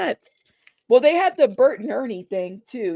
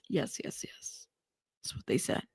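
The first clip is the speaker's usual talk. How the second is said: whispering; 7 semitones lower, 13 dB quieter than usual. Real, not acted. Whispered agreement.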